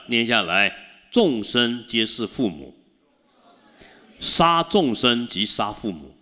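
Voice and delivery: 放慢速度，庄重地一字一句念经文; 像诵读经典一样缓慢庄严; solemn scripture recitation. Acted. (法师为信众诵读经文，缓慢庄严，结尾高声)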